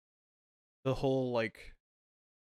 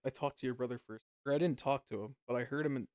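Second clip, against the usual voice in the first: usual speaking voice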